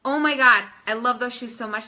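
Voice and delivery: thrilled compliment in a private message; excited gushing. Shown as a demonstration. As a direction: gush over the shoes.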